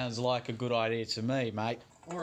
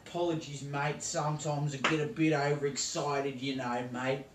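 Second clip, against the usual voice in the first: broad ocker Australian accent played for laughs; hammed-up Aussie accent. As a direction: play a cartoon Aussie TV cook. Parody host continues.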